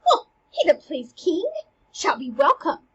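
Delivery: lightly acted delighted surprise, pitch jumping; excited. Teacher playing Hamlet hearing actors are coming, excited. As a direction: perk right up at the good news. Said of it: "he gets excited and he's like".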